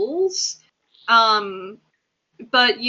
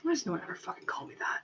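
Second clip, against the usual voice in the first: whispered grumble; 3 semitones lower, 13 dB quieter, much faster than usual. Acted. Muttered complaint about his name.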